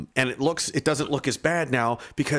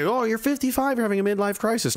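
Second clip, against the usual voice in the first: higher, put-on pitying voice; mocking quoted voice; 10 semitones higher than usual. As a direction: lift it high to parody what people say. Mock quote of what people say.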